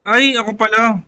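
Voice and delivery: normal talking voice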